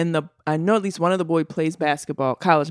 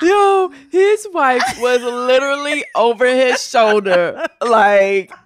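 loud, high, laughing through the words; cracking up, slowed by laughter; real laughter while talking; 6 semitones higher, 7 dB louder, slower than usual. Real, not acted. Laughing at the story.

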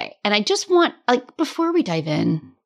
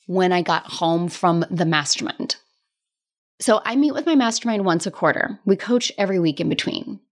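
normal talking voice

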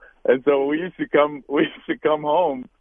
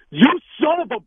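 high, loud mock yell at a rival; retold angry outburst; 5 dB louder, faster than usual. Quarterback at the coin flip cursing the opposing captain, high angry yell. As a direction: snap at him like you're losing it.

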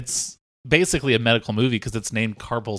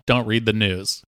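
normal talking voice

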